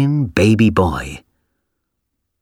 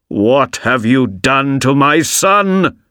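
slow, strained, horrified father shouting; angry shout; 4 semitones higher, slower than usual. A narrator's reading. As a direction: demand it of the dog in disbelief. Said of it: "he shouted at the old dog".

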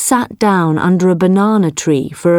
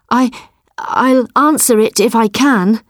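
nervous; 5 semitones higher than usual. Acted. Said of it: "so he said nervously".

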